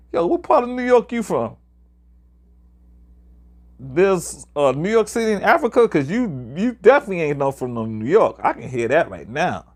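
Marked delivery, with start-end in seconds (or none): 0.1-8.4 higher, teasing street challenge; mock challenge. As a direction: call his bluff with attitude. Retold challenge to fake New Yorker.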